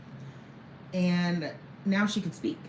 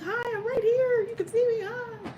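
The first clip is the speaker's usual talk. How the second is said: put-on very high, sing-song baby talk; soothing mother. Mother cooing to her crying toddler at the kitchen gate, very high.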